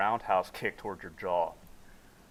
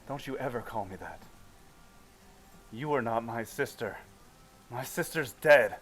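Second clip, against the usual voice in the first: woman pinned against the wall, furious at being called sister; threatening anger; 3 semitones higher, 4 dB louder than usual. Acted. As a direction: growl it, building to the last words. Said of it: "with her fist clench".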